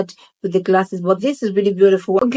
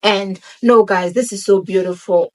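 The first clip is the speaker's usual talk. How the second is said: ordinary delivery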